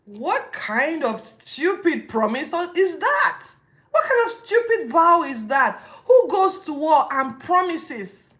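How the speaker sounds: loud, high, incredulous rant with laughing cries; mock the foolish vow, loud and high; exasperated disbelief. Real, not acted. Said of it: "I've expressed how stupid I think that promise was".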